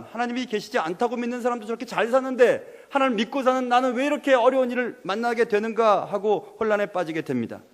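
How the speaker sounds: normal talking voice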